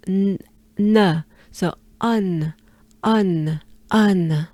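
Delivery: "un" said through the nose, no full N; nasal un. Shown as a demonstration. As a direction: let "un" fade into a nasal hum. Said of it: "will turn into".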